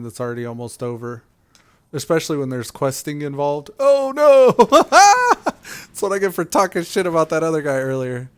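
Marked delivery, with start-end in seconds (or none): 3.8-4.5 loud startled oh no; surprised shout. Real, not acted. Sudden fail in game. 4.5-8.3 laughing loudly while talking. Real, not acted.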